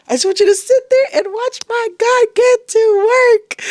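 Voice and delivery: high, loud, slow, almost crying; tearful impassioned cry. Real, not acted. Telling viewers to watch God work, tearful. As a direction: cry it out with full faith. Climax of faith declaration.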